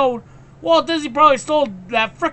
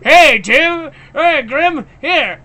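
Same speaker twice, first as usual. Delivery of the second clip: louder, higher friendly call; 5 semitones higher, 6 dB louder, slower than usual. Retold or imitated. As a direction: call over to your friends with an offer. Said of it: "he's like".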